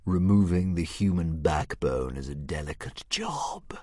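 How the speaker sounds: nasty whispered dragon hiss; hissing serpent dragon. Acted. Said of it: "hissed the dragon nastily".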